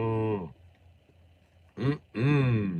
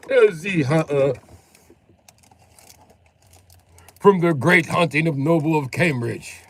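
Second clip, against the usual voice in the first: mock posh British; 5 semitones higher, 7 dB louder than usual. Grand mock British introduction.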